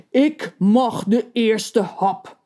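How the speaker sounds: slow menacing tailor; threatening tailor. A narrator's reading. The tailor blocking the kettle with his scissors, slow and menacing. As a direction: lay down the claim slowly, threateningly. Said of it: "dreigend een schaar omhoog".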